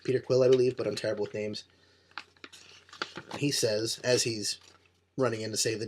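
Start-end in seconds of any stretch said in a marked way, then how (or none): none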